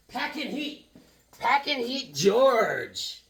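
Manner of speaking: high squeezed sing-out of a nickname; teasing high-pitched cheer; playful nickname call. Real, not acted. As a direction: crow it high, stretching the name.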